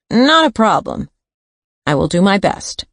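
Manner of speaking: high, nasal, odd-sounding man; strange voice. Acted. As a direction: pitch it up and pinch it. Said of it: "then said in his strange voice".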